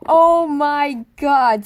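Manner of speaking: loud, very slow dread; stunned gasp. Retold or imitated. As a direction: let it fall out slowly in horror. Seeing Amy with the note.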